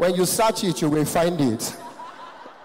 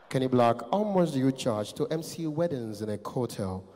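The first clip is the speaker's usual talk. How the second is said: low, softer, thick-voiced tycoon; rich man; 7 semitones lower, 5 dB quieter than usual. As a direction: make the enquiry deep and understated. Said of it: "I heard the voice of a rich man".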